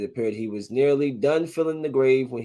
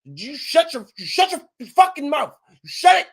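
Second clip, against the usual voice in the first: furious yelling, pitch climbing higher and louder; angry shout; 13 semitones higher, 6 dB louder than usual. Real, not acted. Podcaster yelling at a rival, furious. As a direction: shut him down with a rising yell.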